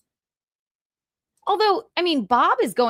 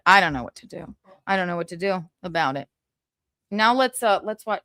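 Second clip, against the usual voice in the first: normal talking voice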